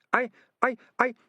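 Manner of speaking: high, halting stutter. Acted. Frightened ratcatcher unable to confess, stuttering. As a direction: get stuck on the first word. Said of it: "the man stuttered".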